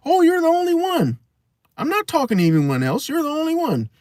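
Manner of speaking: very high, sugary sing-song; mock sweet talk. Very high cooing mock to a boyfriend she's lying to. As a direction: coo it like a sweet liar.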